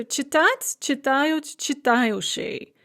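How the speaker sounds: читать, читают, читающий, each accented on the same А; fixed stress on А. Shown as a demonstration. Teacher shows first conjugation forms, stress fixed on А. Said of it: "stress is also fixed on the а".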